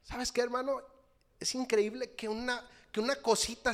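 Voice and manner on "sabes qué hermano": ordinary talk, nothing marked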